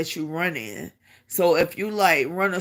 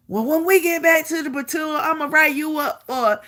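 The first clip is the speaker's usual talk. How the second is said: imitated sergeant's threat; 8 semitones higher than usual. Sergeant threatening to write her up.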